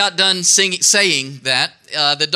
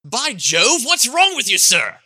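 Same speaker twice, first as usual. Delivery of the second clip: high, indignant British gentleman; affronted British accent, pitch swooping up; distinguished British accent; 6 semitones higher than usual. Acted. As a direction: put on a hammed-up posh British accent. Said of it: "in his best distinguished British accent said".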